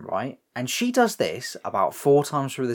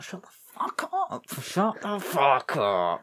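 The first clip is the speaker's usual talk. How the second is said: much higher, then slow and drawn out; Barry snapping; 4 semitones higher, 5 dB quieter, slower than usual. Retold or imitated. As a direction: bark it up high, then drag the second. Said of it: "And Barry's like".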